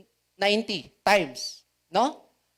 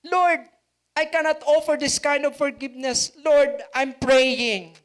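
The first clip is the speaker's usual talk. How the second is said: pleading prayer; 7 semitones higher, 4 dB louder than usual. Acted. Acted prayer.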